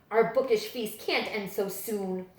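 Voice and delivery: usual speaking voice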